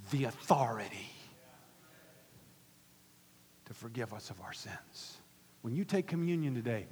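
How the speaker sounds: low, reverent preacher's hush; hushed preaching. Real, not acted. Hushed emphasis after the shout.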